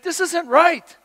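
frustrated David, loud and high; angry David. Retold or imitated. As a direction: voice David's outrage at the cowards. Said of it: "He's angry".

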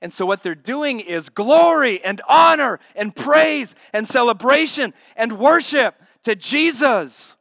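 loud, high, ringing preacher's cry; soaring pulpit praise; full-force preaching. Acted. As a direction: proclaim it to the whole church.

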